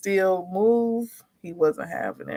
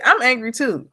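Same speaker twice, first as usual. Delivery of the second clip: higher, mildly angry comeback; mock-angry retort; retold snapping back; 5 semitones higher, 4 dB louder than usual. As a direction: snap back as a fed-up mom, retelling it. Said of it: "and then it just snaps".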